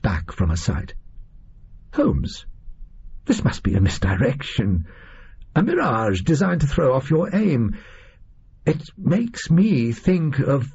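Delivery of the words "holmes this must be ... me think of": disbelieving. Acted. Watson disbelieves what he saw.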